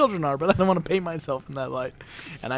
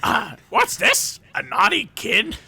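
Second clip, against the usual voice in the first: very high, slow, leering discovery; creepy gleeful Belschnickel; 9 semitones higher, slower than usual. Pretend. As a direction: relish catching the kid. Belschnickel finds naughty kid.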